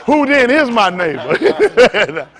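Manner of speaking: slow, loud, laughing mock-pious question; mocking quote. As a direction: drag it out as a teasing church quote. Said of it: "how they say?".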